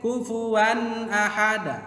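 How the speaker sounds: "kufuwan ahada" chanted high, n short and clear; short clear tanwin. Shown as a demonstration. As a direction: recite "kufuwan ahada" without holding the n. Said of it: "Kemudian pendek". ("kufuwan ahada" dibaca jelas dan pendek)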